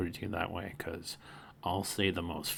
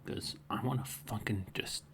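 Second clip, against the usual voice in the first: whisper; 3 semitones higher, 8 dB quieter than usual. Real, not acted. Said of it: "it's so late at night".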